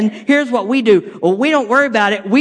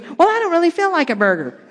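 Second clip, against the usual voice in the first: higher, put-on wife voice; wife's reply; 9 semitones higher than usual. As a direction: play the wife turning down the burger. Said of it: "And then she goes".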